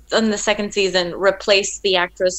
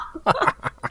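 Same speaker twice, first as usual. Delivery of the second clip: breathy laugh; 10 semitones higher than usual. Real, not acted. Laughing at the memory.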